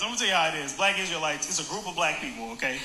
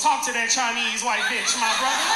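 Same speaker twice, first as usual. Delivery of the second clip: higher, punchy mock heckle; megaphone hype-man; 4 semitones higher, 5 dB louder than usual. Small megaphone guy backing the preacher, mock heckling. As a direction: imitate a sidekick egging him on. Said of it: "small megaphone guy goes".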